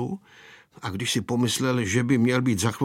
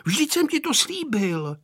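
put-on shouting boy; 11 semitones higher than usual. A narrator's reading. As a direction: insist in a raised boyish pitch. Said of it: "křičel Vojta". (naléhavé zvolání)

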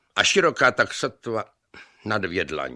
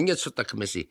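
plain reading voice

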